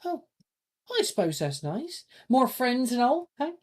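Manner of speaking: bright chirpy pupil; high cheery voice. Acted.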